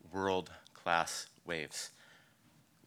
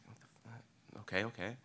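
shocked whisper; 8 dB quieter than usual. Retold or imitated. Walking toward the shark attack.

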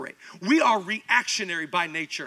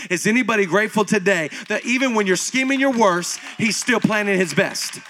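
louder, driving sermon climax; preaching at full force; 6 dB louder than usual. Acted. Pastor rousing the congregation, full-force preaching. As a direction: build and push each promise.

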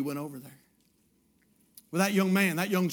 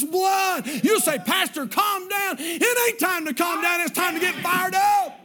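full-force preaching; 10 semitones higher than usual. Real, not acted. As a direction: preach it hot, rising to a roar. Preacher builds to climax.

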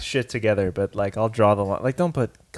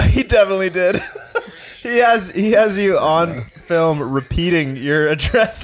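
laughing; 7 semitones higher than usual. Real, not acted. Friend gleefully telling him he was filmed giving his address, laughing. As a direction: tease through real laughter.

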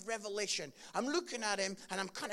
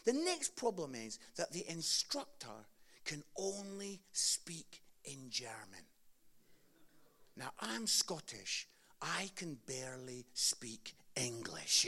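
whispering; 7 semitones lower, 5 dB quieter, slower than usual. Real, not acted. Confiding aside about German instructor.

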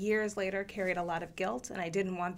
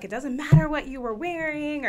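put-on higher, louder supportive teen; voicing the teens; 8 semitones higher, 8 dB louder than usual. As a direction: speak up warmly as the kids. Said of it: "And they're like".

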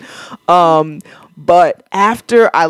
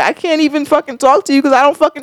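mock exasperated outburst; 8 semitones higher than usual. Venting at fake-positive people.

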